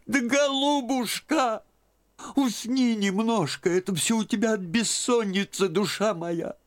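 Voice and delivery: shocked and fumbling for words; babbling old aunt. A narrator's reading. Aunt stammering at Liza's wish for the convent.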